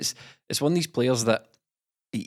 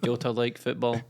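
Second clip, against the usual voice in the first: terse, simple-worded footballer impression; David Turnbull. Podcaster mimicking a player's interview answer, mock-simple delivery. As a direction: lightly mimic a man of few words. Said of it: "here's my David Turnbull impression".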